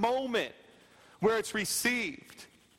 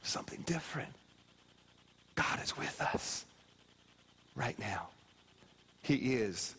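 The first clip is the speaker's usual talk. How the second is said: whispered altar call; 11 semitones lower, 6 dB quieter than usual. Acted. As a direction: whisper God's promise over them. Quiet altar-call murmur.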